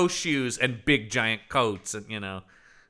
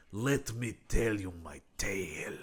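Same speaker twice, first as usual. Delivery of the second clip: hushed, mock-sinister whisper, much softer; creepy whisper; 4 semitones lower, 9 dB quieter than usual. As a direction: lean in and whisper it like a spooky storyteller. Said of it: "he's like".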